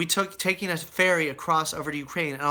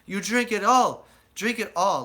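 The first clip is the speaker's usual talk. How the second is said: louder, slow insisting in a put-on voice; mock insistent host; 5 semitones higher, 4 dB louder, slower than usual. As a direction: imitate the men refusing to let him sip. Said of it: "they'd be like".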